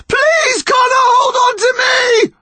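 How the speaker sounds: very high, pleading shriek; terrified scream. A narrator's reading. Mum begging Connor to hold on, terrified scream. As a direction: scream it in mortal terror. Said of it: "His mum screamed in terror".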